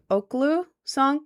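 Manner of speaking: Oklu said slowly, unsure how it goes; tentative Oklu, drawn out; trying a pronunciation. Shown as a demonstration. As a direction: test out the name Oklu carefully. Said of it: "I don't know how to pronounce it".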